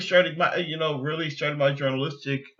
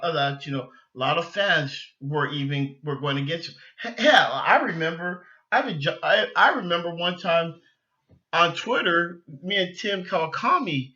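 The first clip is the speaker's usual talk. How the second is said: normal talking voice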